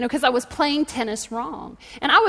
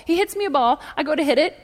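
ordinary delivery

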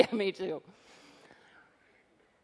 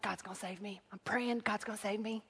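much softer, low murmured faith; hushed stubborn prayer; 5 semitones lower, 15 dB quieter than usual. Retold or imitated. Flood man assuring himself God will save him, hushed. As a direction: mutter it like a private prayer.

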